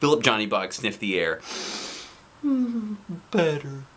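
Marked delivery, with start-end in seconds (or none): 2.5-3.9 small high satisfied toy voice; stuffed elephant. A narrator's reading. Stuffed elephant sniffing air, high and soft. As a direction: sigh it out relieved. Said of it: "he said".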